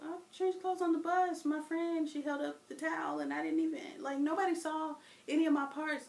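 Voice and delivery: put-on child defending herself; pleading kid. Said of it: "I'm like".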